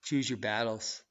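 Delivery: usual speaking voice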